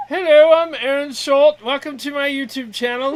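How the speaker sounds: hammed-up YouTube host greeting; mock channel intro, laughing; impression of Aaron. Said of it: "I could do Aaron".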